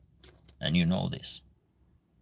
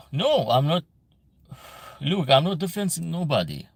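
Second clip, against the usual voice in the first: higher and louder, pushing back hard; defensive denial; 7 semitones higher, 3 dB louder than usual. Real, not acted. Answering a viewer who calls him defensive, defensive and raised. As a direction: insist you're not defensive, voice climbing. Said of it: "You are a bit defensive".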